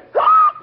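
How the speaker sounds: sharp squeaky hiccup gasp; loud hiccup gasp. Acted. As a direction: yelp out a big hiccup. Hiccup attack.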